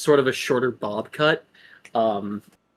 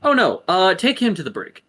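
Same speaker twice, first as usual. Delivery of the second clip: guard's retort; 7 semitones higher, 5 dB louder than usual. Acted.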